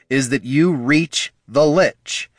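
high, slow, emphatic; loud, insistent repeat. A narrator's reading. Bard overruling Gorm, loud and deliberate. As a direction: hammer it home slowly. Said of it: "Heraldon repeated loudly".

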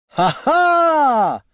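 high, loud, triumphant hero's laugh; ringing, drawn-out "ha ha" of victory; musical, resounding laugh. A narrator's reading. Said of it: "laugh was musical and resounding".

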